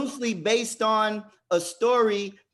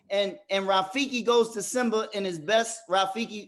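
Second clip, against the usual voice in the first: ordinary delivery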